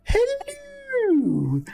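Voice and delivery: very high, drawn-out falsetto greeting; sky-high lady's voice, swooping down at the end; Mrs. Doubtfire. Acted. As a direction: call out in a hammed-up old housekeeper's trill. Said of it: "exactly like the voice that Robin Williams uses".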